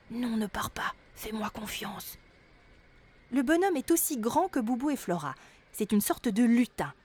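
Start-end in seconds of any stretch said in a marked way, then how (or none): 0.1-2.2 doux murmure qui s'éteint sans voix. Acted. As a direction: murmure pour la rassurer. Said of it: "et murmure". (Boubou retient Flora, murmure rassurant)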